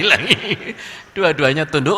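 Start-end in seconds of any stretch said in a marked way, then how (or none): none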